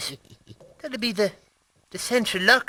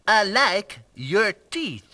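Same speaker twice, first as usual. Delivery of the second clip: slow, halting mock French accent; slower than usual. Shown as a demonstration. Practising phrases aloud, slow French accent. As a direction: space the words out in a put-on French accent. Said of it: "I'm learning the French accent".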